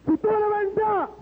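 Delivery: high, stretched-out plea; crying out to God. Retold or imitated. Joey challenging God to prove himself, crying out high. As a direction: call it upward, drawing the words out.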